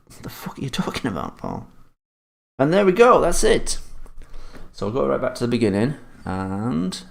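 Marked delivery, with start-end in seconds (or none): 0.1-1.7 hushed, breathless aside; a whispered mock rebuke to himself. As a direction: whisper the viewer's retort. Reads chat reaction aloud.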